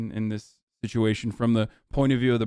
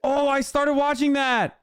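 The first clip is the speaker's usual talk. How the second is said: excited recognition; 14 semitones higher than usual. Real, not acted. Interrupting a friend who named a show, excited. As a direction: burst in, pitch soaring with real excitement.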